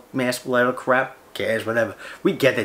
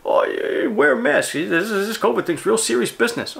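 hammed-up scolding parody, pitched way up; Pelosi; 9 semitones higher than usual. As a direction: mimic an official lecturing everyone.

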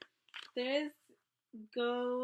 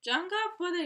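excited; 6 semitones higher, 4 dB louder, faster than usual. Real, not acted. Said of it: "I can't even talk. I'm too excited".

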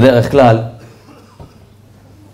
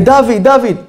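retold calling out; 7 semitones higher, slower than usual. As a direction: imitate her calling his name. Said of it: "היא צועקת".

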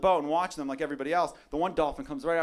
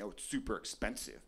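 mock naysayer; 9 semitones lower, 15 dB quieter than usual. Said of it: "by telling me".

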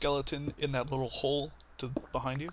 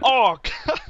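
loud laughing groan; 10 semitones higher, 16 dB louder than usual. Real, not acted. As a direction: laugh out the words. Laughs at buttons mistake.

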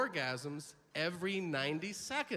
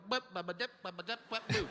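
mock Alabama farmer babble; 4 semitones higher, much faster than usual. Comic imitating a farmer's usual speech for the crowd. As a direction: babble like a mumbling country farmer. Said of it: "a farmer in Alabama who's normally like".

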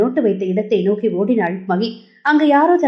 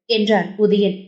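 ordinary delivery, nothing marked

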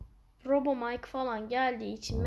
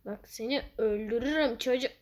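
ordinary talk, nothing marked

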